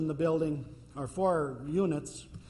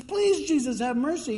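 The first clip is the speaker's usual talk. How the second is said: high, louder plea for mercy; pleading; 10 semitones higher, 4 dB louder than usual. Retold or imitated. Canaanite woman begging Jesus again, high and pleading.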